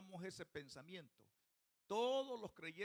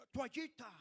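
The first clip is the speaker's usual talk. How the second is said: loud, raised gringo accent on "Toallita"; 6 semitones higher, 6 dB louder than usual. Shown as a demonstration. Preacher mimics the gringo ambassador saying "Toallita", American accent. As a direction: exaggerate an American accent in Spanish, louder and higher. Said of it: "Pero el gringo dice".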